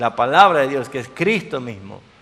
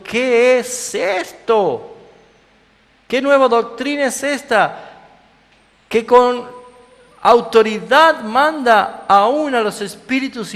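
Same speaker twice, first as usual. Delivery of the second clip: high, loud, slow amazement; astonished crowd; 10 semitones higher, 6 dB louder, slower than usual. Acted. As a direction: marvel aloud at his authority.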